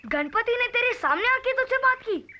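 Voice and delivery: high-pitched incredulous question; amazed disbelief. Acted. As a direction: ask as if you can't believe your friend. Friend amazed by dream claim. (हैरानी और अविश्वास से)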